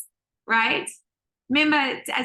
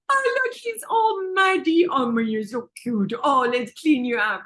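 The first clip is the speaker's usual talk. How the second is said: cooing parent. Acted. Parent to a muddy toddler, cooing. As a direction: fuss adoringly over a muddy toddler.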